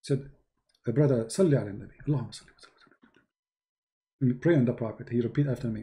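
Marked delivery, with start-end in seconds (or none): 0.9-3.3 mock prayer, rushed and much softer; rote muttered prayer. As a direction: rattle it off under your breath like rote. Mocking callers' rote repeated prayer.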